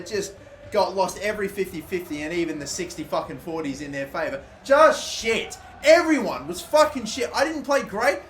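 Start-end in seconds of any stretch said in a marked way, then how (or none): 4.6-8.2 higher, louder disgust; bitter rant. Real, not acted. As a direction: spit out the verdict. Post-match rant.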